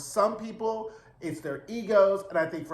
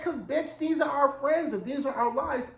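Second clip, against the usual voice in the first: mock sassy retort; 7 semitones higher than usual. C Z snapping back at Truman.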